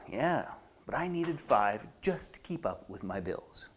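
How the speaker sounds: low, slow, quiet letdown; soft, deflated inner admission; inside voice. Acted. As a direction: sag into a private worry. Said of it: "And the inside voice says".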